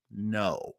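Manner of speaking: usual speaking voice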